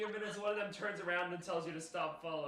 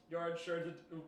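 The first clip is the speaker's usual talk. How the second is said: ordinary talk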